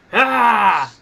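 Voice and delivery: loud, drawn-out wrestler's cry; annoyed roar. Acted. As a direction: let out a mock annoyed yell. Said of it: "So she's like".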